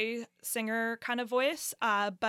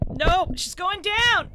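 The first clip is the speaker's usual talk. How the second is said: high, loud alarmed cry; 9 semitones higher, 11 dB louder, slower than usual. Real, not acted. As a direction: yelp as it falls. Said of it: "This is the worst".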